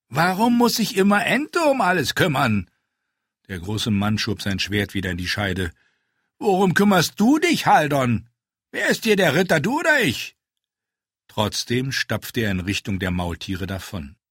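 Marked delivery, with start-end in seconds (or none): 0.1-2.7 higher, louder mock complaint; a hammy aggrieved giant; grumbling big man. A narrator's reading. Ente complains to Haldon about always doing everything. 6.4-10.4 much higher and louder indignant jab; indignant retort. A narrator's reading. Ente retorts to Haldon about who is the knight.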